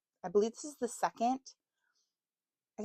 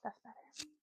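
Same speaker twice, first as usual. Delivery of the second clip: soft whispered aside; 3 semitones higher, 15 dB quieter than usual. Real, not acted. Vlogger whispering an aside after cutting footage. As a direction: breathe it out quietly.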